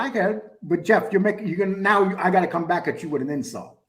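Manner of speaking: ordinary delivery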